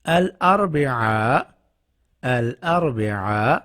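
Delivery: الاربعاء drawn out slowly as al-arbi'a, rising end; slow pronunciation. Shown as a demonstration. Teacher slowly introducing الاربعاء to students. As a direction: stretch الاربعاء for learners. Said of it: "بدھ یعنی کہ Wednesday".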